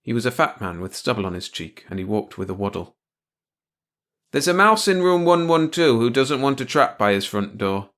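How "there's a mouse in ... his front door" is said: lightly voiced janitor, higher and louder; simple-spoken janitor. A narrator's reading. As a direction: report it simply, pitched up. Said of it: "the janitor said simply".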